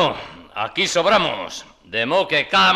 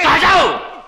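loud, high bark to shut up; shouting down the quarrel; furious shout; 9 semitones higher, 6 dB louder than usual. Acted. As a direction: yell them all into silence. Silencing everyone.

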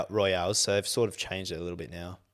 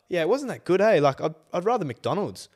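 higher, light mock mum voice; imitating mum; 8 semitones higher than usual. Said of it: "And Mum's like".

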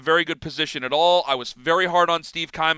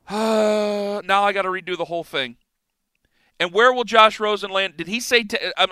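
ordinary talk, nothing marked

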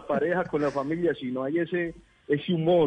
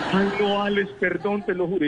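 put-on higher, pleading girlfriend voice; 8 semitones higher than usual. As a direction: beg him, high and sorry. Imita a la novia suplicando. (novia suplicando con voz aguda)

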